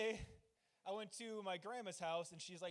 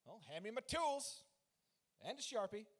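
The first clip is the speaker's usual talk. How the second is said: hammed-up confidence, pitched up; mock gung-ho; 4 semitones higher than usual. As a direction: play the eager fixer calling for gear. Acting eager self-styled handyman answering wife.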